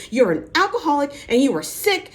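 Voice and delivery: loud, high imitation of a fight; retold angry accusation. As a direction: hurl it like a mock tirade. Retold quarrel in the limo.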